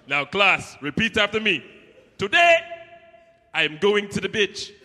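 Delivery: put-on loud African-accented classroom voice; teacher's mock accent. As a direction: lead the class in a heavy accent. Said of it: "He's like".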